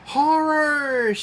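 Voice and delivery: high, loud screamed exclamation. Acted. Impala about to crash, screaming in panic. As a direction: shriek it in panic. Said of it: "Chucky screamed".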